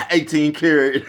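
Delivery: loud real laugh over the punchline; bursting out laughing at his own joke; big laugh. Real, not acted. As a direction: crack up laughing, slow and loud.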